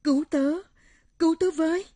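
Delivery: high, loud cry for help; desperate wail. Acted. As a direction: scream for help, pleading. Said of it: "kêu gào thống thiết".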